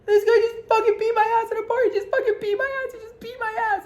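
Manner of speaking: very high, wailing mock sob; squealed, hammed-up crying voice; imitated hard crying. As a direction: imitate a drunk friend bawling. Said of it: "she's crying, like, pretty fucking hard".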